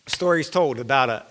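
usual speaking voice

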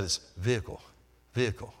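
"vehicle" as VEE-uh-kul, with no h; Australian pronunciation. Retold or imitated. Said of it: "That's the way they were saying it".

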